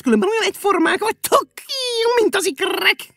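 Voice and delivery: very high, loud voice breaking with laughter; a springy creature cackling at the shoes; laughing-crying creature. Acted. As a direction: scold them while laughing.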